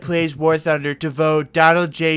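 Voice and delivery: lightly voiced Trump bluster; a hint of Trump showmanship; Trump impression. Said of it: "This is Donald J. Trump".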